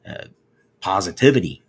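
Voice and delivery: ordinary talk, nothing marked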